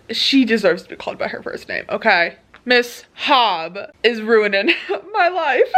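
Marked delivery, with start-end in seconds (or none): 2.6-5.8 laughing through tears. Real, not acted. Crying over the book.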